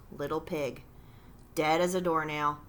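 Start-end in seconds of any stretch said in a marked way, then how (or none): none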